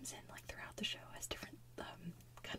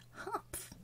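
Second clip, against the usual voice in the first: higher, scornful little humph; sour kangaroo humph; 9 semitones higher, 5 dB quieter than usual. Retold or imitated. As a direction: sniff at him with disdain. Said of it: "the sour kangaroo comes in and goes".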